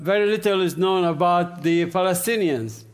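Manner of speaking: usual speaking voice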